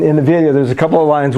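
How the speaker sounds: usual speaking voice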